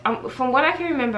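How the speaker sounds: ordinary delivery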